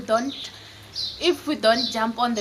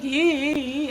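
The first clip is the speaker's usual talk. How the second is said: mock wail, pitched up; imitated crying; 4 semitones higher, 4 dB louder than usual. Teller imitates herself crying on the phone, mock wailing. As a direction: act out bursting into tears. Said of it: "I start crying".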